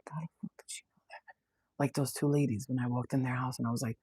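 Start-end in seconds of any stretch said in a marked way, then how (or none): 0.1-1.2 fast, unvoiced whisper of disbelief; inner whisper. Psychic imitates a stunned client's inner whisper, fast and breathless. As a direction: hiss it fast as a shocked private thought. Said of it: "I could see their brain going".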